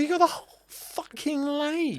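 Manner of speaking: imitated high-pitched American outrage; mock an exasperated American, pitched right up; American accent. Retelling friend's reaction to being bumped on the lake, high American accent. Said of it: "she speaks with an American accent".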